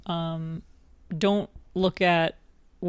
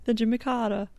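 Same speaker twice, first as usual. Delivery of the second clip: high, r-dropping mock Southern accent; unconvincing Southern politician accent; Jimmy Carter; 4 semitones higher than usual. Co-host insisting on the accent's source, poor Southern impression. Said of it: "it doesn't even sound like Jimmy Carter".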